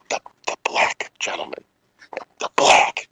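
imitating aunt's furtive whisper; susurro imitado. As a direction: drop into a hushed, voiceless whisper, imitating a wary aunt. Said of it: "she lowered her voice and she said".

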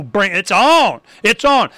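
ordinary delivery

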